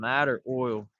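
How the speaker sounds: ordinary talk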